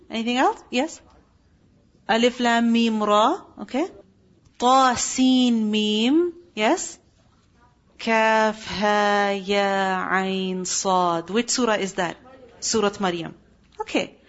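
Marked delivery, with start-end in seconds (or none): 4.6-6.3 Ta Sin Mim drawn out, each letter held apart; slow recitation. Shown as a demonstration. Teacher recites Ta Sin Mim for students, slow and separate. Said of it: "teacher recites the letters". 8.0-11.3 Kaf Ha Ya Ain Sad drawn out, each letter held apart; slow recitation. Shown as a demonstration. Teacher recites Kaf Ha Ya Ain Sad for students, slow and separate. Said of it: "teacher recites the letters".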